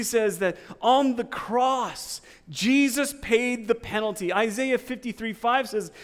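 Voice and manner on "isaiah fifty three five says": usual speaking voice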